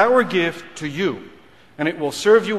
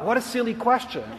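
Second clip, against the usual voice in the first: higher, softer voiced inner scoff; dismissive thought; 5 semitones higher, 5 dB quieter than usual. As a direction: think it to yourself, lightly dismissive. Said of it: "I thought".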